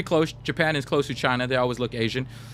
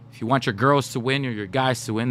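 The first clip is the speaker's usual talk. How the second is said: ordinary delivery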